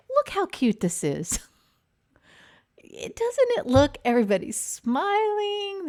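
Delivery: real excitement at a cute picture; excited gush. Real, not acted. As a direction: gush over how cute it is. Gushing over a cute photo.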